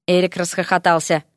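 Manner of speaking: ordinary delivery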